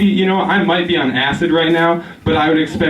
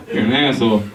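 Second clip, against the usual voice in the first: ordinary delivery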